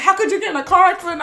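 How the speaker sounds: high, quick, rushed scold; mock scolding. Linda scolding her sister.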